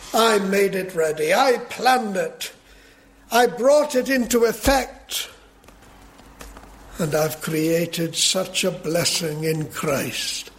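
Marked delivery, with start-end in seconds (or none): 0.1-5.3 raised, climbing pulpit declaration; emphatic preaching. Real, not acted. Preacher speaking as God who planned it all, emphatically proclaimed. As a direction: drive each statement higher as you preach.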